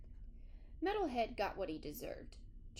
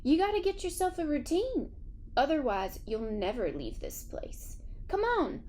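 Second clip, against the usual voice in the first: higher and louder, urging kindly; coaxing old dog; 5 semitones higher, 5 dB louder than usual. A narrator's reading. Geraldine urges Metalhead to find a routine, higher, louder coaxing. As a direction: coax the stubborn newcomer along.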